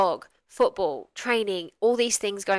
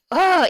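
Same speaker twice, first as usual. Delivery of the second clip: exasperated oh; 10 semitones higher, 8 dB louder than usual. Retold or imitated. Imagined frustrated person reacts.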